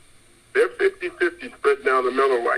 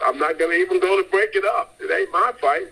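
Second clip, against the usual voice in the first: ordinary talk, nothing marked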